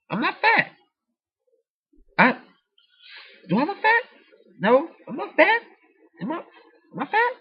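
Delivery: very high, squeaky mock protest; mock indignant squeak. Speaker acts out denying friend's teasing about being fat, squeaky mock indignation.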